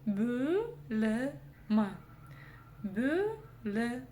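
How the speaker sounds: «бөлім» медленно, по слогам; растяни «бө», произнося его как «бё»; «ө» через «ё». Shown as a demonstration. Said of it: "Снова взяли за основу букву". («бөлім» раздельно, с мягким «ө»)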